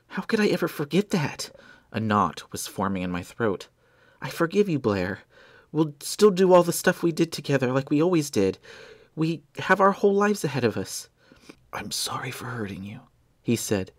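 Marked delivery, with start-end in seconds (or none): 0.1-1.5 choked up. A narrator's reading. Grieving friend, knot in throat. 4.2-11.1 high, louder desperate hope; tearful pleading. A narrator's reading. 11.7-13.0 breathless faint whisper; gasping whisper. A narrator's reading. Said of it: "gasping for air".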